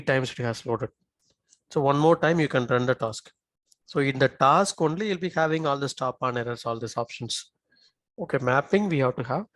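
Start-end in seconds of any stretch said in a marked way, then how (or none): none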